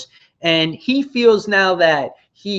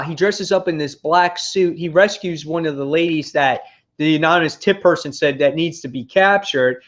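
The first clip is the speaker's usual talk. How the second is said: ordinary delivery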